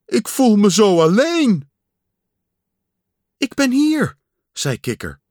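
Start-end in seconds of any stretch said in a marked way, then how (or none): 0.1-1.7 high, loud lament; raised, pleading wail; lonely cry. A narrator's reading. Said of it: "riep hij". 3.4-4.2 high-pitched little answer; piping reply; Frog's high voice. A narrator's reading. Small Frog answers Pat's cries.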